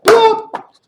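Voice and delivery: very high loud popping plop; sound-effect plop. Shown as a demonstration. Teacher leading the children in a loud high plop. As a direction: pop it like a stone in water. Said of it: "we're all going to say".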